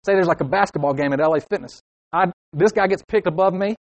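normal talking voice

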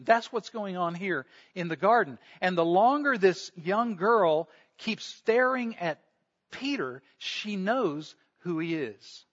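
ordinary delivery

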